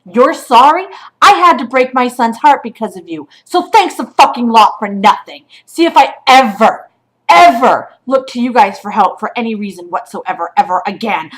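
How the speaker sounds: angry tirade. Real, not acted. Mother tells the shelter off, bitter and loud. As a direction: tear into them.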